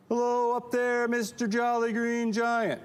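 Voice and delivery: high, drawn-out call up to a giant; small creature hollering upward in a high sustained voice; Trid calling up. Acted. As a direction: sing out slowly at a very high pitch. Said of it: "They would go up and they go".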